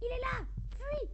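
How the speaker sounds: high panicked cry; scared horror game streamer. Real, not acted. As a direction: yelp it out in fright as the monster shows up. Monster appears, warns partner.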